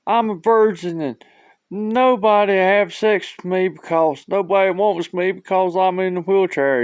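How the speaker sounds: high, loud comic wail; hammed-up tearful lament; mock bawling. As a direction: imitate a woman bawling out her troubles. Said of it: "She starts bawling".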